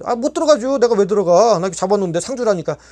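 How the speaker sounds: 높이 치솟는 거부; 절대 못 들어간다고 버티기; 반발하는 항의. Retold or imitated. 들어가라는 지시에 반발. (들어가라는 말에 높게 대드는 말투)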